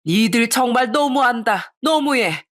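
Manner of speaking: slow anguished shout. Acted. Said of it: "그렇게 제가 소리를 치자".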